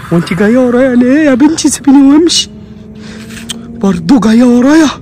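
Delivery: loud, high yelling at a dog; high alarmed shouts; panicked pleading. Real, not acted. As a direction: beg it loudly to leave you alone. Pleading with a dog chasing him.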